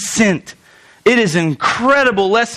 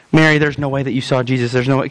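much lower, fast dismissive voice; doubting disciple; 9 semitones lower, faster than usual. Retold or imitated. Disciple dismissing Mary, low and fast. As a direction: brush Mary off as impossible. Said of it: "they're sitting there".

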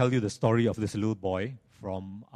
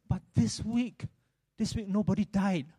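mock lament; 7 semitones higher than usual. Sad man's mock-mournful punchline.

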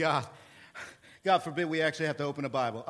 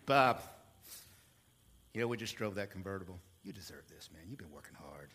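softer, smooth, fast sales patter dropping to a hush; car salesman; 7 semitones lower, 5 dB quieter than usual. Retold or imitated. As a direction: ease the customer into the deal. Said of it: "as a car salesman, I used to say".

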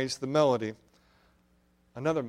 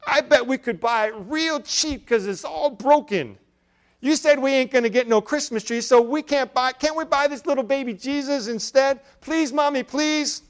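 put-on high, louder child's voice, begging with a laugh; pleading little girl; 10 semitones higher, 5 dB louder than usual. A narrator's reading. Little girl begs her mother for the broken doll, high pleading child voice. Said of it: "exclaimed the girl".

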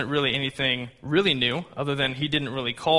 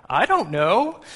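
higher, louder feigned innocence; mock innocent; 6 semitones higher, 6 dB louder, slower than usual. Young son denying he made his brother cry, mock innocent. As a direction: imitate a little boy playing innocent.

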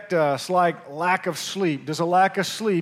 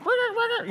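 high squeaky chirp of a cricket; cricket chirp imitation; 3 dB quieter, much faster than usual. Pastor mimics the cricket keeping him awake, high chirping. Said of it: "that cricket would go to cricketing".

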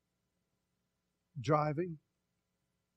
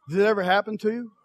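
usual speaking voice

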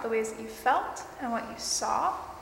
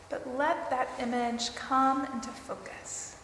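guided meditation; 3 dB quieter than usual. Acted.

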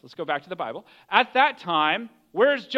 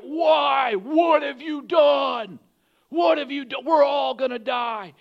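drawn-out, high acted shout; yelling; 6 semitones higher, 4 dB louder, slower than usual. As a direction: shout over crashing waves in desperation. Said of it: "They're probably yelling at him".

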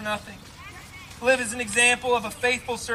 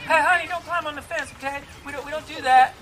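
imitating a mom, higher-pitched and polite; gentle chiding mom; 4 semitones higher than usual. Mom calling to her kid at the playground, higher mild chiding. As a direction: lightly scold a child in a mock-mom voice.

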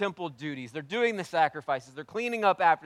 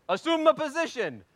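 mock drill order; 11 semitones higher, 5 dB louder than usual. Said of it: "it's just like".

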